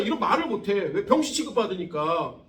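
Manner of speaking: normal talking voice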